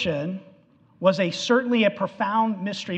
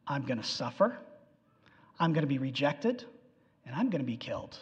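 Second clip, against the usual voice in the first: hushed, much softer and lower dread; fearful follower; 6 semitones lower, 10 dB quieter, faster than usual. Retold or imitated. A follower fearing his own fate, hushed low dread. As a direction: murmur it low, realizing the danger. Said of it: "Well, wait a minute. I'm a follower".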